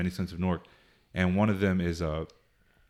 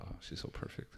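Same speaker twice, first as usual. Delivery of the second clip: whisper; 20 dB quieter than usual. Real, not acted. Aside before Carmela voice.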